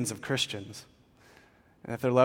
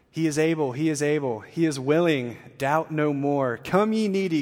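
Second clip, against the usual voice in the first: declaimed verse, strong line openings easing at endings; hymn reading; 4 dB louder than usual. Acted. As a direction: proclaim the hymn as heartfelt invitation. Reads hymn lyrics aloud.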